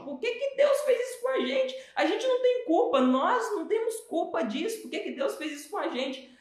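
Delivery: high, whining complaint of the people; whiny victim voice. Retold or imitated. The people whining to God about their fate, high whining voice. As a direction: wail it like self-pitying grumblers.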